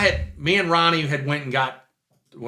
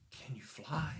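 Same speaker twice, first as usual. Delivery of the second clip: put-on breathless whisper of a wide-eyed little kid; awed whisper; 20 dB quieter, slower than usual. Little kid asking the Superman mechanic if he can fly, whispering. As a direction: whisper it like a child in awe.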